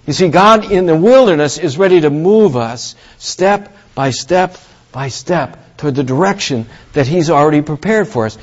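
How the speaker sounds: ordinary delivery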